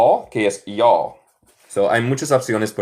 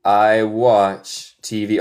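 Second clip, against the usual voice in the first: slow model sentence; much slower than usual. Shown as a demonstration. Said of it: "teacher repeats model".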